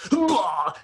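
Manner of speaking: high-pitched imitated vomiting noise; comic imitation of throwing up; mock retching. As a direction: burst out a sudden mock puke sound. Imitates cousin suddenly throwing up.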